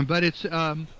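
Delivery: normal talking voice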